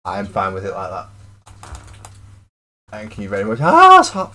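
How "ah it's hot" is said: sudden high yelp at the heat; loud burnt-mouth cry; hot food yelp. Real, not acted. Biting into fresh hot pizza.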